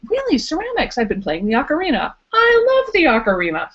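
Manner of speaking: excited small talk. Pretend. Imagined coworker replying excitedly.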